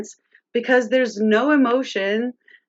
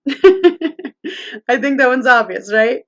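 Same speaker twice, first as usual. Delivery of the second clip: laughing; 2 semitones higher, 6 dB louder, slower than usual. Real, not acted. Laughs at obvious answer.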